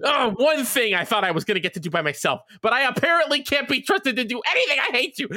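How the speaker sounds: high-pitched furious tirade, not shouted; angry in-character rant. Acted. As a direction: rant at him in a high, hurt, furious voice without screaming. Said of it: "Like, I went off".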